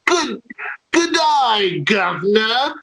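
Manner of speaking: high-pitched hammed-up English accent; mock English accent. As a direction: put on a cartoon Cockney English accent. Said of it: "English accents and you saying".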